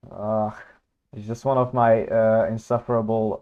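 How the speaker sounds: ordinary delivery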